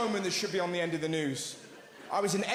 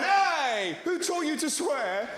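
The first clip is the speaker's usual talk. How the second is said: father yelling at cursing son; dad scolding; 7 semitones higher, much slower than usual. Retold or imitated.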